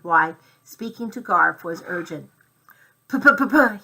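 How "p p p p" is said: louder p-p-p engine sputter; motor sputter. A narrator's reading. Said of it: "he sputtered to show Garf".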